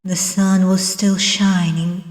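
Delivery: plain reading voice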